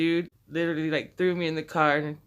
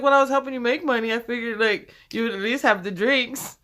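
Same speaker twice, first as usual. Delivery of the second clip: defensive dad; 6 semitones higher, 5 dB louder than usual. Retold or imitated.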